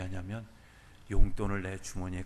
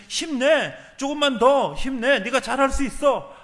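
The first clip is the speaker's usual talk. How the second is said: loud, raised cheering on; encouraging; 14 semitones higher, 8 dB louder, slower than usual. Retold or imitated. The Lord encouraging a fallen believer, loud cheering. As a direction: cheer them up like a coach who believes. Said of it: "격려해 주신다는 거예요".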